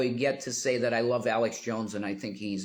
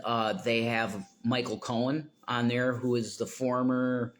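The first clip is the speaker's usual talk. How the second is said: ordinary delivery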